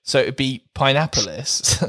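"Pineappolis", "pine-AP-o-lis"; pineapples like Minneapolis. Shown as a demonstration. Host demoing the swap, pineapples like Minneapolis. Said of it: "pronounced like Minneapolis".

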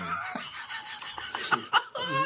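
unvoiced high laugh; breathy laugh. Real, not acted. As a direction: laugh at the bad roll. Laughing at nat one.

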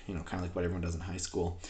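ordinary talk

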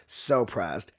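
SO PRESSED dropped low; low aside. Real, not acted. Commenting on Madeline's outburst, low aside.

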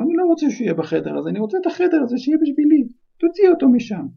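high, loud whining tantrum; spoiled child yelling. Acted. Spoiled son yells at his mother downstairs, whining tantrum. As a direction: scream at mum until she gives in. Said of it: "שמע את דאדלי צועק לאמא שלו".

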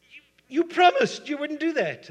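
loud, high-pitched betrayed cry; outraged protest. Acted. Bitten man accusing the snake of breaking its word, outraged protest. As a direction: stammer the start, then yell in acted outrage.

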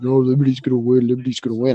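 imitating a friend's blurred mumble; mumbling. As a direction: mumble it like there's a toaster in your mouth. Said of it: "why are you mumbling like that".

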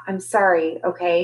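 ordinary delivery, nothing marked

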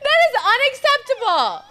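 high, loud laughing outrage; laughing protest. Real, not acted.

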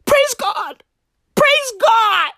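usual speaking voice

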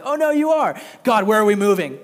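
cheerfully insist, almost shouting; hammed-up gleeful God; excited God. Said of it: "And God's like".

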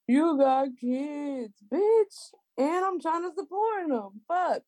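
high, slow mocking of a rude man's message; mock angry guy. Woman mocking a rude guy's message to her. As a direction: read the creep's message in a jeering high voice.

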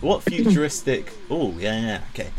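normal talking voice

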